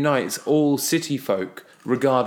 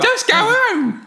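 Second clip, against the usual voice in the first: much higher, louder, slow country-yokel accent; 5 dB louder, much slower than usual. Shown as a demonstration. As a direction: drawl "Just go home" in a broad country accent. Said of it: "I'm trying to do an accent".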